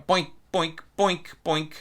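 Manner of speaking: high, springy boinks mocking a bouncy staccato. Shown as a demonstration. Teacher mocks the players' overly bouncy staccato, high boinks. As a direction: bounce each boink up high like a cartoon bunny. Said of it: "fluffy bunny bounce kind of feeling".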